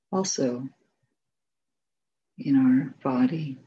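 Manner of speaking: unhurried meditation guidance, long silences between phrases; guided meditation. Real, not acted. Teacher guiding sitters to notice sound, silence and body, spacious meditation voice. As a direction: lead them gently, phrase by phrase, leaving room to rest.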